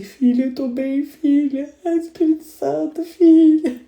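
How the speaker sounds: high, crying through the words; mother crying in worship tells her daughter she's fine, high and tearful. Retold or imitated. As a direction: sob it out while reassuring your daughter.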